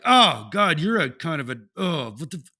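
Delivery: loud exasperated road-rage rant; annoyed outburst. Retold or imitated. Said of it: "I'm like".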